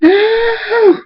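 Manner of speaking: piercingly high, long wail; screaming. A narrator's reading. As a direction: scream it out in grief, holding the vowel. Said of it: "Scootaloo screams".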